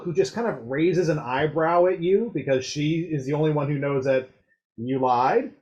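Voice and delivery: ordinary delivery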